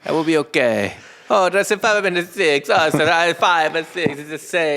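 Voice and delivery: put-on Italian accent. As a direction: keep the bad Italian accent going. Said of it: "so I'll just do Italian".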